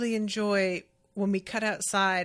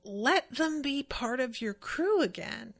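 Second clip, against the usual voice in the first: higher, eager mock Luffy plea; a lightly voiced higher Luffy, pleading; imitating Luffy; 5 semitones higher than usual. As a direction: pitch up and plead like a pleading kid captain. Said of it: "And Luffy is like".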